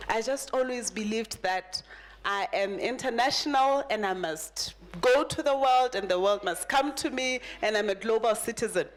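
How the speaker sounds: ordinary talk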